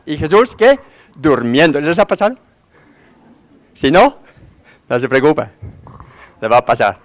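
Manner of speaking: normal talking voice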